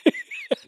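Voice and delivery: squeaky burst of real laughter; high-pitched laugh. Real, not acted. Reacting to absurd casting news.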